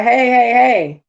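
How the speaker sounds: high, loud, drawn-out hail; calling out. Retold or imitated. Worker hailing a helper at the barn, calling out. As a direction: call a coworker over from a distance.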